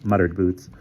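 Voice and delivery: usual speaking voice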